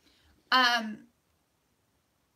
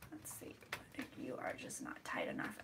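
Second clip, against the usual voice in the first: whispering; 19 dB quieter than usual. Real, not acted. Whispering to the camera mount.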